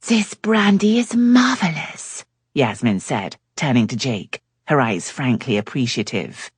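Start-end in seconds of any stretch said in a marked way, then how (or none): 0.1-2.2 high, slow, admiring French lady; Yasmin's French-tinged voice. A narrator's reading. Yasmin praising the brandy to Jake, high and slow. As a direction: purr it like a charming Frenchwoman.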